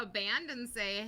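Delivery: ordinary talk, nothing marked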